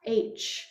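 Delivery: soft unvoiced H on a sigh. Shown as a demonstration. Teacher shows students an H breathed out on a sigh. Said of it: "go ahead and just do that".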